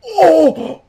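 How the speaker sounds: high loud panicked cries; screaming for help. Acted. Grabbed in game, crying for help.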